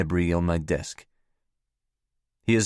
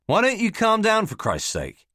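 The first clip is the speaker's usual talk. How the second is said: exasperated; 11 semitones higher than usual. Acted. Saul telling Nick to calm down.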